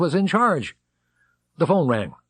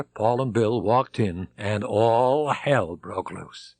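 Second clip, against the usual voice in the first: plain reading voice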